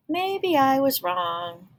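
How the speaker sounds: slow, sorry musing; a touch of regret; regretful. Acted. Mother's regret in bubble.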